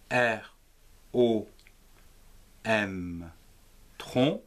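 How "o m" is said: ordinary talk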